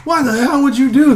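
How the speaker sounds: shouted high and loud; mock parent yell. Parent yelling at son home from war, mock outraged shout.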